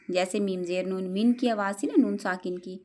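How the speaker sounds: normal talking voice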